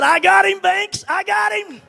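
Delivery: high, loud laughing triumph; gleeful crowing. Retold or imitated. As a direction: crow over the scared boy. Uncle crowing after the prank.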